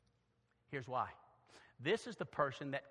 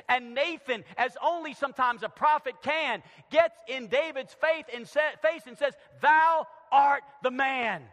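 loud, raised preaching voice; full-force preaching; 7 semitones higher, 11 dB louder than usual. Acted. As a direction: drive each phrase out to the congregation.

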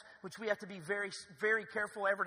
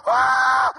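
loud mock scream of the boaters; imitated scream; 5 semitones higher, 20 dB louder than usual. Pastor imitating people stranded on the lake, mock scream. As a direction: belt out a scream. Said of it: "I mean, screaming".

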